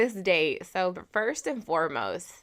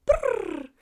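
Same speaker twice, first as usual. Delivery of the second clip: high rolled bird chirp; bird trill; 12 semitones higher, 3 dB louder than usual. Retold or imitated. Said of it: "like a fucking bird".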